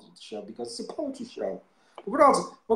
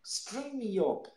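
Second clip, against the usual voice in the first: mock dramatic recital; 6 semitones higher than usual. Quoting a poem dramatically.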